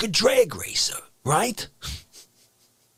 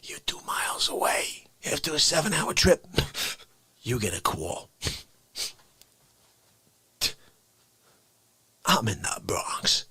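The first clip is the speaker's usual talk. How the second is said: whispering; 9 semitones lower, 6 dB quieter than usual. Acted.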